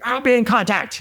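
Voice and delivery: high-pitched imitation of a fuming general; retold angry sign-off; angry parting shot. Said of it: "And he storms off".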